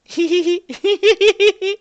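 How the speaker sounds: high-pitched villainess titter; high giggle. Acted. Character giggles while walking away.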